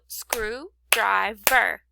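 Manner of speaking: "screwdriver" slowly split into screw, drive, ver; three separate beats on "screwdriver", a gap after each; clapped-out syllables. Shown as a demonstration. Teacher showing pupils the count, "screwdriver" in three spaced beats. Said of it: "Clapping it out helped me".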